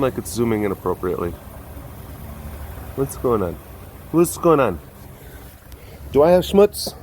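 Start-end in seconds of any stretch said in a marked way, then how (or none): none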